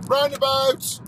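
high, loud sung-out call; roundabout shout. Pretend. Dedicated to Sam from Canada, high loud shout. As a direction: belt it out high on one held note.

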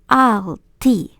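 "Alltid" said as "alti", final d dropped; silent final d. Shown as a demonstration. Teacher models "Alltid" without the final d. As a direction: say "Alltid" with no d at the end. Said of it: "ми не вимовляємо останню літеру Д".

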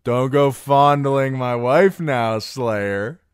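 loud, high, slow jovial warning; merry, drawn-out drunken jest; laughing drunken teasing. Acted. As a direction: tease a friend with boozy mirth.

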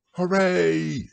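loud, high cheer; shout. Acted. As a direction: yell it up to the sky with joy. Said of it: "you shouted".

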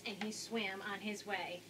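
plain reading voice

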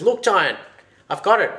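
high, loud proud announcement; triumphant. A narrator's reading. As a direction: burst out with the news. Boy triumphantly shows the bird.

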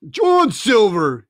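slow, weighty squire's address; a lightly voiced offended squire; stern squire. A narrator's reading. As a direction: lean on the name like a stern gentleman.

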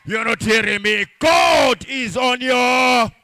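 loud, rising sermon cadence over the music; preaching at full force. Acted. Pastor rallying the congregation, loud preaching. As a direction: preach it to the whole church.

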